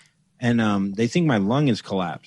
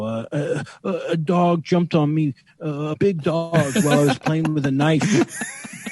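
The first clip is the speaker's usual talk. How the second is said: higher, hurried fib to a cop; flustered nervous lie; 5 semitones higher than usual. Retold or imitated. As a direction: blurt out a lame excuse, flustered.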